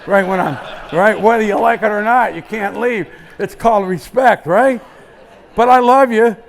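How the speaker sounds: ordinary talk, nothing marked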